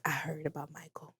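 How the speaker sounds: whispered, somber sympathy; hushed condolence whisper. Retold or imitated. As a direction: breathe it like sharing bad news. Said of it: "calling me like".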